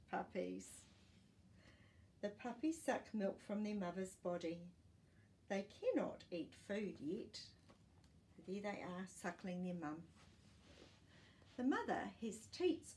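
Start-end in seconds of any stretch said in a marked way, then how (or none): none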